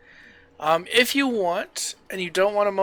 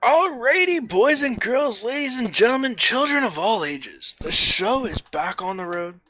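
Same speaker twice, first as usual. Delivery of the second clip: showman announcer; 5 semitones higher than usual. Acted. Opening a stream like showman.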